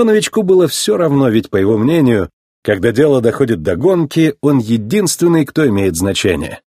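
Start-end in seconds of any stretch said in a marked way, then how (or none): none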